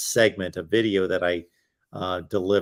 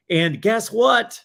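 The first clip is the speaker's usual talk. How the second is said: excited; 11 semitones higher, 6 dB louder, slower than usual. Real, not acted. Hyping up the Patreon news.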